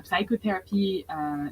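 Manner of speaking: ordinary talk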